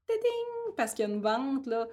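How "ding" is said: sing-song notification ding, much higher; phone chime. Retold or imitated. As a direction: imitate a cheerful phone chime. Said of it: "quand mon téléphone fait".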